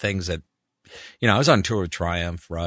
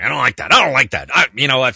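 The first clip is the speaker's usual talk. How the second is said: annoyed; 3 semitones higher, 8 dB louder, faster than usual. Retold or imitated. Director rejecting a voice take.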